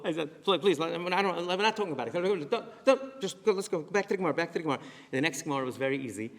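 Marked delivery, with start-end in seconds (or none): 0.4-4.8 feigned awkward teacher, higher and louder; mock uncomfortable. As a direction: pretend embarrassment, shooing the class on. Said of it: "I made believe I was uncomfortable".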